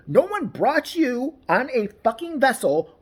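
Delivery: ordinary talk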